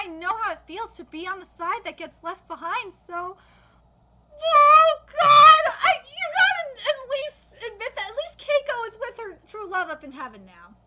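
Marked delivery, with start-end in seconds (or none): 4.4-7.6 loud, high cry of dismay; real tearful outburst. Real, not acted. Manga reader overcome by a sad backstory, tearful outburst. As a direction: wail it, overcome by the story.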